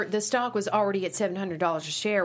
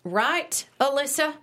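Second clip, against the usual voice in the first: pointed prompt; 6 semitones higher, slower than usual. Acted. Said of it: "Alyssa pauses, prepared to make a snide comment".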